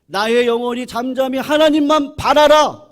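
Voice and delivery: loud, declared. Shown as a demonstration. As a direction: proclaim it boldly. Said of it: "어떻게 하고 있다고요".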